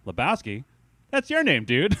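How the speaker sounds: high, loud Donnie impression; imitating Donnie. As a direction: squeak it out like Donnie. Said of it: "one of the first things he says is just like".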